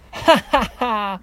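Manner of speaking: high, loud storybook ha-ha; acted laugh. A narrator's reading. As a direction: laugh as a mocking little pig. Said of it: "laughed the first little pig".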